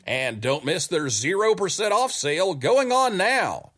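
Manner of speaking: upbeat sponsor ad voice; ad read. Acted. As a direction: read it like a radio commercial. Reads the sponsor ad.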